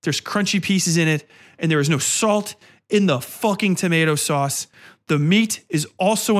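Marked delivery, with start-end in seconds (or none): none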